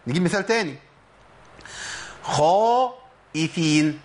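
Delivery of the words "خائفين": خائفين with a heavy full خا, drawn out; slow correct demo of خائفين; heavy kha. Shown as a demonstration. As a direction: say خائفين with an emphatic kha, slowly. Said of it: "ده الصواب".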